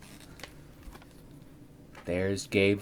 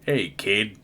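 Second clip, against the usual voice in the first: growl. Acted. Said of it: "Gabe growled".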